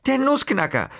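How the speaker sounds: put-on high, loud child's yell of fright; panicked child yelling at his mother; breathless yell. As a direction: scream out the name of the scary thing. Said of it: "brüllte Kaspar atemlos".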